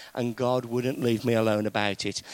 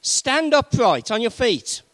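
louder, raised commanding call; 9 semitones higher, 8 dB louder than usual. A narrator's reading. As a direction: proclaim it like Paul to the crippled man. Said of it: "said in a loud voice".

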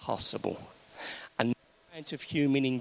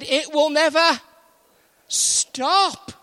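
raised, drawn-out scornful certainty; mock doubter; 13 semitones higher, 7 dB louder, slower than usual. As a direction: ham up the sceptic's confident claim. Mocking the doubters again.

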